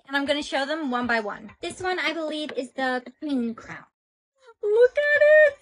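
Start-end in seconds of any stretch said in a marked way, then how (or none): 4.6-5.6 very high, loud gush; excited squeal. Real, not acted. Reviewer shows off queen crown, high excited squeal. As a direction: squeal with delight, pitch shooting way up. Gushing over the crown shown.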